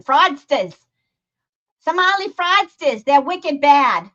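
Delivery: hammed-up Boston accent with dropped r's; loud, playful Boston townie accent. Shown as a demonstration. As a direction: lean hard into a broad Boston accent. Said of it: "Sometimes my Boston accent comes out".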